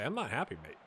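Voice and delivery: normal talking voice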